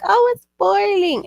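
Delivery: high, loud exclamation imitating worried people; mock alarm. Imitating people who complain about their melons, mock alarm. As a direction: raise the pitch sharply, like someone fussing.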